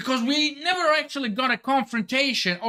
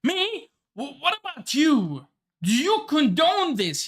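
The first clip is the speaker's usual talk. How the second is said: high, lightly voiced incredulous Dragon; a hint of Dragon challenging the officer; 6 semitones higher than usual. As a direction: toss the question back in mock disbelief. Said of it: "No, this is Dragon speaking".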